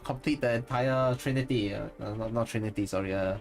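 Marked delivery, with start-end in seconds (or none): none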